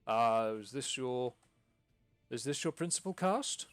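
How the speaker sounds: stuffy nobleman looking down his nose; pompous lord. Acted. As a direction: lord it over the theatre folk. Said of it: "Lord Fortescue looks up and goes".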